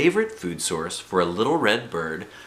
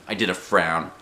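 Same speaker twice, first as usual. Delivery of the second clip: usual speaking voice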